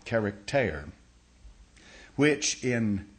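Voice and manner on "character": "character" ending in a long "tair"; Greek pronunciation. Shown as a demonstration. Teacher repeating the Greek word for the congregation, "character" ending "tair". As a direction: give the last syllable of "character" a long A, "tair". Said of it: "long A, character".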